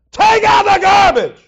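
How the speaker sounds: loud, high, slow bellowed command; parent's shout at a child. Retold or imitated. Parent shouting an order at their child. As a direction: yell it at the kid who won't listen. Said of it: "Woo, there's a difference".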